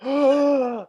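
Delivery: high sharp gasp; excited gasp. Real, not acted. Host picking marry fuck kill, excited gasp. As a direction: gasp as the idea hits. Realising the answer.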